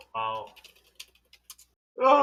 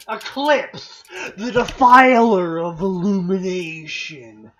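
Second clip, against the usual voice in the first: slow, grandly proclaimed weapon names; theatrical reveal with big punched openings; dramatic announcer; 11 dB louder, slower than usual. Pretend. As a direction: announce each one like a legendary weapon.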